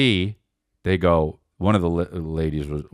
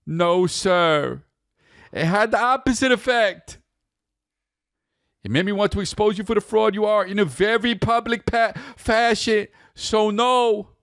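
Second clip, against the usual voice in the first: much higher mock reading voice; 13 semitones higher than usual. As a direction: read it puffed up and grand. Reading statement in voice.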